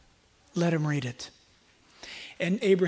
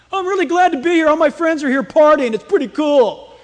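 high, loud, gleeful partygoer; mock party enthusiasm; 8 semitones higher, 11 dB louder than usual. Preacher mocking what the rich man never said, gleefully loud. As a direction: belt it out like a happy partier, tongue in cheek.

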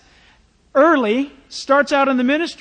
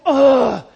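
groan; 2 semitones higher, 8 dB louder than usual. Retold or imitated. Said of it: "you're just gonna go".

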